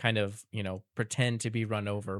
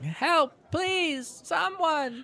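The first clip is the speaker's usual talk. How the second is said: very high, plaintive fake cry; mock calling for help; much slower than usual. Fake victim calls to the nearby wagon, mock calling for help. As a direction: call out in hammed-up distress. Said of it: "he'd be calling".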